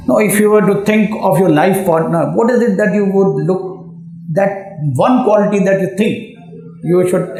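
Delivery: ordinary talk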